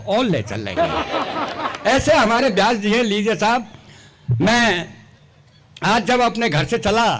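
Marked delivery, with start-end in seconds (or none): 0.1-1.9 laughing. Real, not acted. Punchline through laughter.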